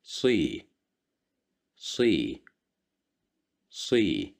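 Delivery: صي said three times, emphatic s with i; صي repeated as a model; syllable drill. Shown as a demonstration. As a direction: drill صي for learners. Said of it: "kasra right below".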